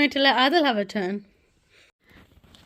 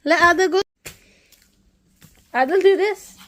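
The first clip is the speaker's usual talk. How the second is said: usual speaking voice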